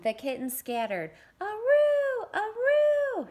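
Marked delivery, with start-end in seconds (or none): 1.4-3.3 high, loud, long howling aroo; dog howl. Acted. Dog howls for joy at the kittens, loud and high. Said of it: "she howled as she chased".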